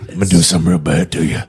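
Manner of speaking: menacing low voice. Acted. Menacing fast voice.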